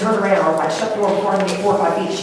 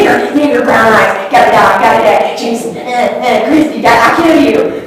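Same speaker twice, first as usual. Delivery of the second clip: loud, high mimicked ranting; imitated crazed attacker; 5 semitones higher, 10 dB louder than usual. Attacker staring at her victim, imitated crazed ranting. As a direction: throw out broken threats loudly, imitating her.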